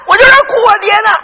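high, tearful shouted retort; insistent mourner. Acted. 向老头辩称哭爹. (带哭腔高声辩解)

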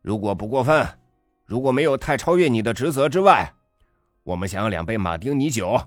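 mock politeness. A narrator's reading. Father sneers politely at waiter.

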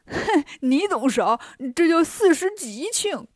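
slow, smug comic retort; scoff, then lift into a high punchline; chuckling Bajie. A narrator's reading. Bajie after banging teaspoons as percussion, gleefully smug. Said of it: "八戒笑着说".

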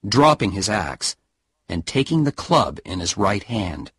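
plain reading voice

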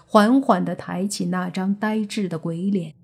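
plain reading voice

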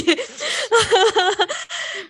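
high, squealing laugh, almost crying with mirth; helpless laughter. Real, not acted. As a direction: laugh until it nearly turns into tears. Laughs at the strange story. (笑到快哭出来)

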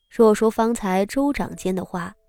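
plain reading voice

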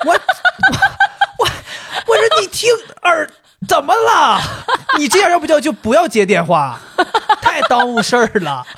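high, loud rant broken by laughter; laughing rant. Retold or imitated. Mocking the clerk who misheard his name, laughing and ranting. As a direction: vent at the clerk while cracking up.

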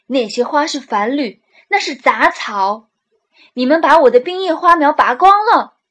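loud, exasperated scolding; furious at the lost seedlings; angry. Acted. Angry boss scolding them.

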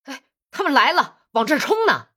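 loud, high warning shout. Acted. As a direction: yell the alarm from the roof. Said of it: "大声喊道".

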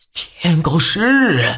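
high, slow cry of alarm. Acted. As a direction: gasp it out in dread. Crowd cries out at eclipse.

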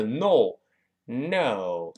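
"no" in the wrong flat Chinese way, slow; mis-say "no" on purpose. Shown as a demonstration. Teacher shows the wrong "no", slow. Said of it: "而不是".

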